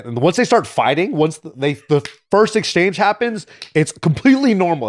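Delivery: ordinary talk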